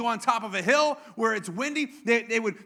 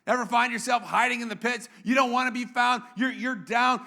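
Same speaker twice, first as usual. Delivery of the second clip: ordinary delivery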